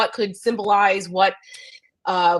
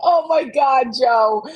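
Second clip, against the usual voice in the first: higher, laughing disbelief; cracking up at the joke; 5 semitones higher, 4 dB louder, slower than usual. Real, not acted. Reacting to Joe's joke, laughing in disbelief.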